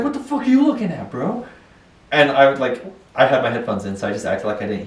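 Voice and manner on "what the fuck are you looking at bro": high, fast mock aggressive taunt; mock angry challenge. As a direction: imitate a stranger picking a fight. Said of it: "he got upset with me".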